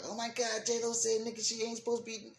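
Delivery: high, shocked gasping voice; mock horrified gossip; mock outrage. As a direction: squeal it like a scandalized fan.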